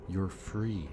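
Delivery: ordinary delivery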